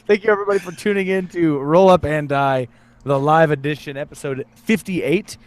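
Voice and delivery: announcer voice, slowing at the end; podcast sign-off. Acted. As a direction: sign off the show like a host. Show sign-off.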